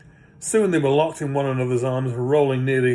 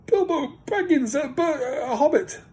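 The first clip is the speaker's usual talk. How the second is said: very high, thin, trembling hobbit voice; frightened hobbit. Acted. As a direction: squeak it out, terrified of the trolls. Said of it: "shaking all over".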